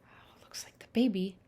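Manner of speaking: hushed, quick whispered aside. Acted. Librarian whispers to the kids about the picture. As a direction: lean in and whisper the news.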